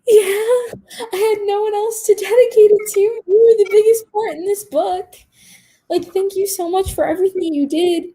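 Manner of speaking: high, loud, crying through the words; tearful thanks. Retold or imitated. Student thanking her teacher through tears, high and loud. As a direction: thank her while your voice breaks into tears.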